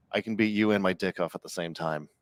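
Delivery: ordinary talk, nothing marked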